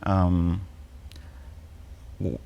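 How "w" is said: ordinary talk, nothing marked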